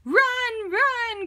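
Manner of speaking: terrified hedgehog yelling; panicked cry. A narrator's reading. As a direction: shout at them to flee. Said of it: "cried Hedgehog".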